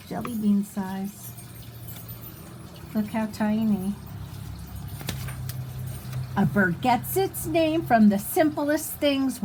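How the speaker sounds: ordinary delivery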